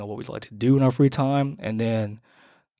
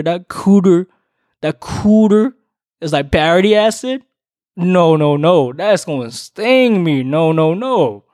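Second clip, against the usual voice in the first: mock disgust; 5 semitones higher, 5 dB louder, slower than usual. Joking about sour cooter.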